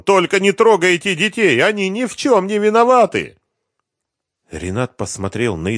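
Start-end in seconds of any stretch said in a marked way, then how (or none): 0.0-3.4 high, loud, desperate plea; frantic pleading; begging. A narrator's reading. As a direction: implore them in a high wail. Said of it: "умолял он".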